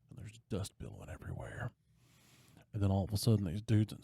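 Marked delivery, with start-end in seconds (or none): 0.1-1.7 fast whisper. Real, not acted. Dramatic scene narration.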